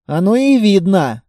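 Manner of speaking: plain reading voice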